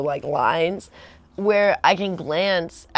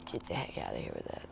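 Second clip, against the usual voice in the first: whispered dismissal; 17 dB quieter, faster than usual. Retold or imitated. Girlfriend brushing off bodybuilder's workouts, quick whisper.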